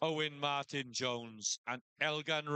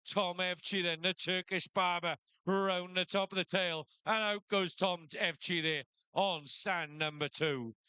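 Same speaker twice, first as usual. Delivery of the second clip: shearing commentator; 4 semitones higher than usual. Acted. Live shearing play-by-play.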